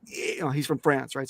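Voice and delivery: normal talking voice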